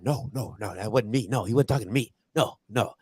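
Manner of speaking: low, quiet string of hasty denials; sheepish denial. Retold or imitated. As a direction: act out backing down, hushed and low.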